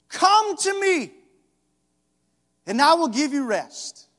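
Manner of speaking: high, loud preaching at full force; fiery preaching. Acted. Preacher shouting to the congregation about Jesus' call. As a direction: drive it home to the room.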